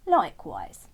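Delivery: slow, loud frog voice, teasing; cheeky frog. Acted. Frog answering Terence's glare, loud cheeky frog voice. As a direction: brush off his look cheekily.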